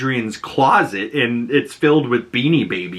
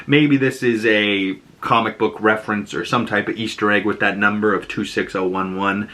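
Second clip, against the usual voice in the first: ordinary talk, nothing marked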